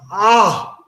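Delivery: short high mock gasp at the stink; retold gasp of disgust. Kid at the gas pump reeling from the smell, mock gasp. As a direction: imitate the kid recoiling with a sharp "Ah!". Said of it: "and he goes".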